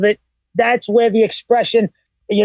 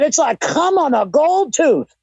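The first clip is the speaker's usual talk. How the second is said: Jim Cornette impression; 7 semitones higher, slower than usual. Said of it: "Jim Cornette said".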